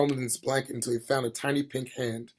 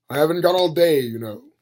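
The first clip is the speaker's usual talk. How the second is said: louder impatient reproach; reproachful; 2 semitones higher, 7 dB louder than usual. A narrator's reading. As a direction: chide the old wizard. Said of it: "said Death, reproachfully".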